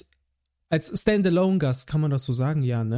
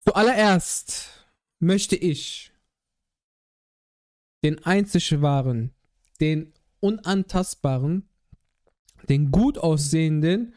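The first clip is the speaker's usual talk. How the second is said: announcer hype; slower than usual. Acted. Grand introduction of guest.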